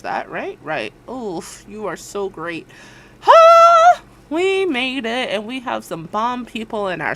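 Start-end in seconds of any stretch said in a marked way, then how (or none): 3.2-4.0 very high, loud "ah"; celebratory cry. Real, not acted. Host celebrating with viewers, high loud whoop. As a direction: let out a high, sustained cry of release.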